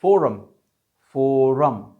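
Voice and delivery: फोरम said forəm, R sounded; forum with R. Shown as a demonstration. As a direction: say फोरम keeping the R. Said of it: "यहां पर भी हमने आर पढ़ा".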